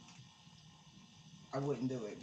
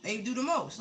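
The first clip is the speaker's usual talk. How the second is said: excited; 4 semitones higher, 10 dB louder than usual. Real, not acted. Exclaiming about the tarot spread.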